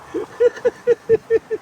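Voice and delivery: loud, high burst of laughter; high squealing laugh. Real, not acted. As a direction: crack up in a squeal. Laughing loudly at dance.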